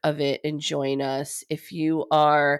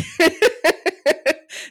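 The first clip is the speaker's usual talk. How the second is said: laugh; 13 semitones higher, 6 dB louder than usual. Real, not acted. Bright laugh about the video.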